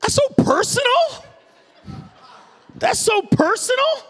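squeaky high, hammed-up offense; mock offended. Teasing a pushy shop clerk, hammed-up offended squeak. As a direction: play the affronted customer, way up high.

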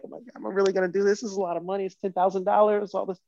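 high, rushed worried self-talk; mock nervous. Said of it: "I drive over all scared".

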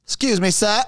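high, slow, scolding Australian shop guard; mock store enforcer. Shop guard scolds a customer in the wrong aisle, high and slow.

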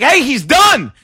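high, loud retold call; urgent calling out. Man running out to two smoking comics, calling loudly. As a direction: call out like someone running up with news.

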